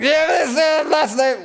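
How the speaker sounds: high, slow slurred holler; loose, drawn-out mimicry of a yell; slurred hungover yelling. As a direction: garble a repeated shout while hungover. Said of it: "it's all kind of slurred".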